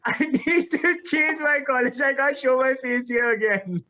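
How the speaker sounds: mock despair breaking into laughter; embarrassed laughing. Storyteller too embarrassed to return to college, laughing it out.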